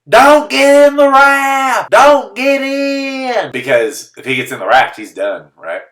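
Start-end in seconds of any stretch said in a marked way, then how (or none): 0.1-3.5 high, slow, drawn-out shout; imitating yelling. Spectators yelling at a swept-away runner, long high shout. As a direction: bellow a warning from the riverbank. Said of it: "everyone's just yelling".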